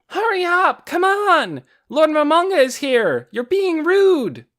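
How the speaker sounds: put-on high, loud girl's yell; shouting. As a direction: yell up at the stands. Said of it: "and shouted".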